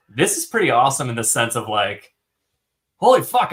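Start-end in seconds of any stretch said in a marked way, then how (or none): 3.0-3.5 sudden thrilled outburst; excited exclaim. Real, not acted. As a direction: burst out loud and high. Excited about seeing Age of Legends.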